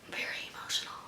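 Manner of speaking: near-silent whisper; hushed admission. Real, not acted. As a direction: let the voice drop away to a whisper. Admits being emotional, voice drops out.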